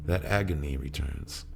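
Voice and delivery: ordinary delivery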